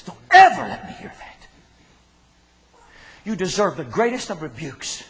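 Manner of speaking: loud, high stern warning; preacher thundering a warning at the church; stern rebuke. Real, not acted. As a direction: rebuke them hard from the pulpit. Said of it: "preacher rebukes congregation".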